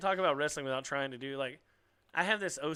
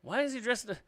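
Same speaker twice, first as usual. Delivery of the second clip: higher, louder realisation; mock surprised onlooker; 9 semitones higher, 5 dB louder than usual. Onlooker asking about a child's dress, mock surprise. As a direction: pitch it up as a puzzled onlooker.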